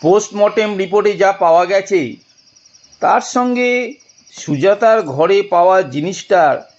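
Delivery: ordinary delivery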